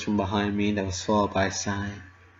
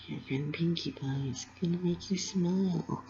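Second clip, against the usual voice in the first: much softer, tender hush; soft whisper; 5 semitones higher, 12 dB quieter than usual. A narrator's reading. Pinkie comforting sobbing Dash, very softly. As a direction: comfort her in a hushed voice. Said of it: "she whispered".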